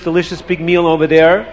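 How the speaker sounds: usual speaking voice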